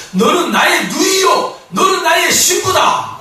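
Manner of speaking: high, slow proclaiming shout; declaring love. Acted. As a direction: cry it out like a royal vow. Solomon declaring to the Shulamite.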